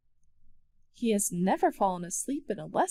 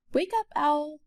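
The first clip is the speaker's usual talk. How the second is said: magpie call; 6 semitones higher, 4 dB louder than usual. A narrator's reading. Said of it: "caws the magpie".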